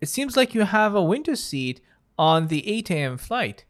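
bright, high airline clerk; friendly check-in agent greeting a passenger; customer service voice. Acted. Airport clerk role again.

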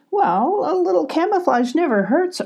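high-pitched playful retort; arguing back. A narrator's reading. Muddy Molly defends her camouflage to Abby, arguing back. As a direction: protest lightly in a high character voice. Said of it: "argued Molly".

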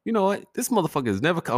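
sudden high fast outburst; high-pitched rant about granddad; exasperated outburst. Real, not acted. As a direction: fire it off, exasperated.

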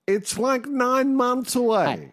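high, drawn-out whiny protest; mock whine. Co-host needles his mate over early Christmas songs, mock whining. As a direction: whinge it high, stretching the last words.

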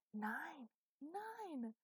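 high, drawn-out disbelief, climbing; mock shock. A narrator's reading. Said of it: "reeled back as if in shock".

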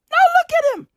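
high mock-horrified "Now look at him"; shrill pretend despair on "look"; mock dismay. Shown as a demonstration. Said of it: "repeated with different delivery".

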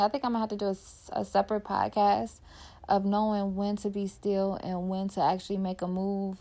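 ordinary talk, nothing marked

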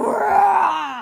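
loud, high mock bear roar; polar bear roar. Imitating the jumping animals, polar bear roar. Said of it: "like a polar bear".

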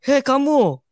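high, loud yell of hey you; imitating a yelling kid; shouted call. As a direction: yell it across the hall. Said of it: "Terus gua teriak".

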